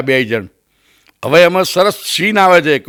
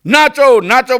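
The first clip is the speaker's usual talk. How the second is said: high mock shout of a command; high, insistent retold yell; crowd-style shouting; 7 semitones higher, slower than usual. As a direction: imitate film villains yelling orders. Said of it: "બધી બૂમ પડતા".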